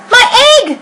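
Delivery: shouted. Acted. Horton hearing his egg hatch, a high excited shout. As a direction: burst out with amazement. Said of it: "shouted Horton".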